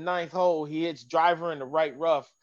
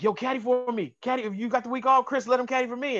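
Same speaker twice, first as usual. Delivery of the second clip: raised higher, quick eager plea; Jumbo asking; 8 semitones higher, faster than usual. Retold or imitated. Golfer Jumbo in the lot asks the caddy, higher and quick.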